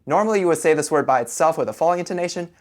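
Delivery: ordinary talk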